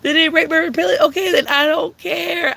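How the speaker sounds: high, loud exasperated whine; mock shrill rant. Ranting to listeners about store theft, high exasperated whine. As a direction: throw the question up high in disbelief.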